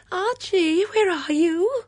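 high, drawn-out anxious call; loud piping wail, slowing at the end. Acted. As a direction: call for a husband in the dark. Woman calls out in the dark.

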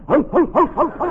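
high, loud bursts of ho ho; laughing. Acted. Boyer stalling for a French word, laughing loudly.

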